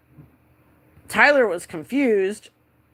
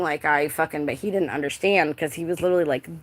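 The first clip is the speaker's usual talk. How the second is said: ordinary talk, nothing marked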